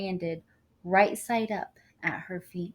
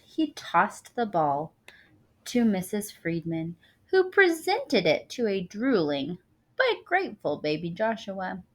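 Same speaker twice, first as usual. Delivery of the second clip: plain reading voice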